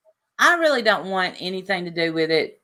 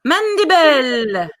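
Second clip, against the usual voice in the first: high, slow, sing-out mock accent; German lady; 8 semitones higher, much slower than usual. Co-host doubts the impersonation, high drawn-out call. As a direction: stretch the name in a hammed-up accent. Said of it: "German lady says".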